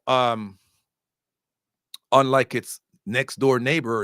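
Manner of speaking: ordinary talk, nothing marked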